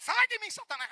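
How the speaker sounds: a believer rebuking temptation; mock rebuke of Satan. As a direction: cast him out with force. Rebuking the devil.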